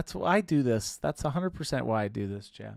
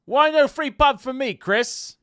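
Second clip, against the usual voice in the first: loud, high mock British accent; British accent impression; 12 semitones higher, 8 dB louder than usual. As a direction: ham up a thick British accent from Hull. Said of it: "'Cause he talks like this".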